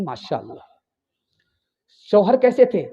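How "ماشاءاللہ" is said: hushed reply. Acted. Daughter answers mother's question.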